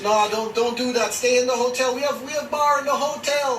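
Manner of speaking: high-pitched, soft mock Jamaican receptionist; Jamaican accent. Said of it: "I'm gonna do my Jamaican accent".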